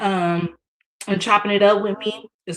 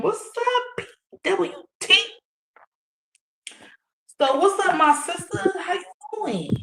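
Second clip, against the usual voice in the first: much higher, bubbly welcome; excited greeting; 11 semitones higher than usual. Real, not acted. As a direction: greet the guest brightly. High greeting to guest.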